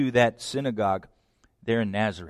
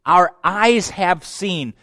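emphatic; 4 semitones higher, 4 dB louder, much slower than usual. Acted. Preacher quoting John emphatically.

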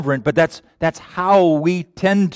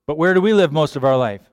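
usual speaking voice

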